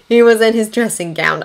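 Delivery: ordinary delivery, nothing marked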